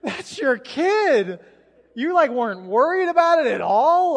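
loud, high-pitched disbelief; hammed-up outraged astonishment; acted incredulity. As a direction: ask it as if utterly appalled. Retold disbelief at casual parents.

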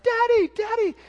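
very high, loud imitation of a kid; super excited child. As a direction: squeal it like a thrilled little boy. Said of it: "he's super excited".

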